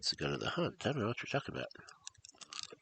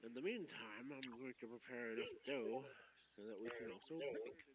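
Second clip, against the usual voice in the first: same witch line, much softer; soft echo; 5 semitones higher, 18 dB quieter than usual. Acted. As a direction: say it again very softly.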